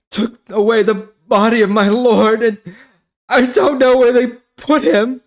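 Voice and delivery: high and loud, a pleading woman's voice; grieving Mary. Acted. Mary answering the angels at the tomb, high anguished plea. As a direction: plead through the grief.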